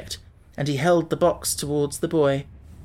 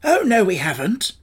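higher, emphatic contradiction; protesting; 5 semitones higher, 4 dB louder than usual. A narrator's reading. Scarecrow corrects Tip about the wasted wish, protesting. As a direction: object firmly, voice lifted. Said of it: "protested the Scarecrow".

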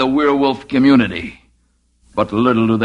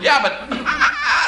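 breaking up laughing; slower than usual. Real, not acted.

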